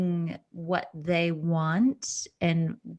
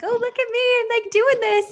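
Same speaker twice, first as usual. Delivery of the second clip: high, giddy show-off voice; mock proud self; 6 dB louder than usual. Aspiring actor mocks her past self, high and giddy. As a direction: squeal it like you're thrilled with yourself. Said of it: "And I was like".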